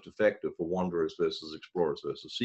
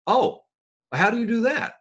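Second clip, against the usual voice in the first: excited, eager question; 5 dB louder than usual. Retold or imitated. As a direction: voice jumps high, bright with interest. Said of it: "It should be greeted with".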